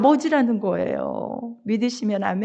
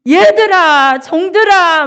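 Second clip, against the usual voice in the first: father calling the servants at full voice, high and loud; summoning the servants with a loud joyful call; 6 semitones higher, 10 dB louder than usual. Acted. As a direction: shout across the house to the servants. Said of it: "막 이렇게 큰 소리로".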